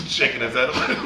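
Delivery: ordinary talk, nothing marked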